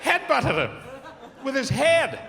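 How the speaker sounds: thrilled like it was Christmas; excited. Real, not acted. Excited retelling of pub fight.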